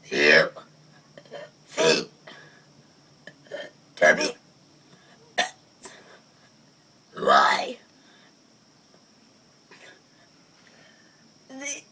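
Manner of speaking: letters pushed out as burps; burping the words. Shown as a demonstration. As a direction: burp each letter deep and loud. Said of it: "Burping style".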